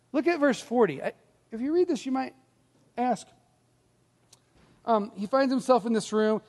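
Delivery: usual speaking voice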